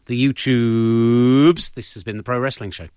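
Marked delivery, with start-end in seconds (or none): 0.1-1.7 playful sign-off echo, very slow and louder; mock drawn-out echo. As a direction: stretch it out slowly, a bit louder, teasing. Echoing co-host's joke sign-off.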